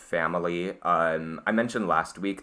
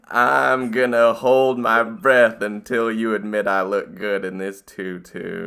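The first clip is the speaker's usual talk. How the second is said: acted card line; 3 semitones higher, 7 dB louder, slower than usual. Reading card in a put-on voice.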